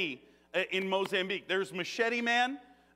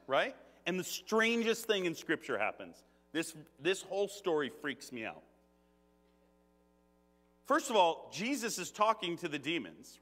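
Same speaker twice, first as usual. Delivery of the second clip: usual speaking voice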